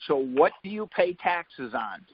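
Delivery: ordinary talk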